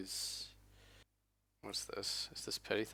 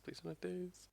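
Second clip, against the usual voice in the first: higher, softer, strained plea; high pleading squeak; 9 semitones higher, 11 dB quieter than usual. Real, not acted. Pleading again, higher and far.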